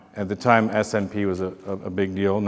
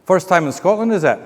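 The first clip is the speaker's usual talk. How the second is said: higher-pitched Scottish lilt; Scottish hotel worker; 7 semitones higher than usual. Retold or imitated. Hotel worker greeting a guest in the elevator, imitated Scottish accent. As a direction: imitate a chatty Scottish local. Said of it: "a guy who works at the hotel".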